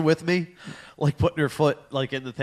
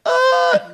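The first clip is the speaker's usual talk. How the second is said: very high, loud yelp; imitated shriek for the photo; short piercing "ah"; mock startled scream; 7 dB louder than usual. Imitates startled face in picture.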